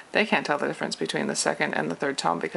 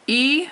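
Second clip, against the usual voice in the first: "E" held high and level; first tone; 9 semitones higher, 4 dB louder than usual. Shown as a demonstration. Teacher showing first tone, high level "E". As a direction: sing "E" on one long high note. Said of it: "first tone".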